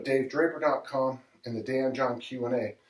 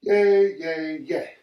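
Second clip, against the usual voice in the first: real cheer; 5 semitones higher, 7 dB louder, much slower than usual. Real, not acted. Cheering himself after finishing set.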